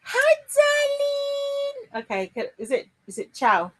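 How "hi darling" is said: excited greeting. Real, not acted. Greeting a returning friend.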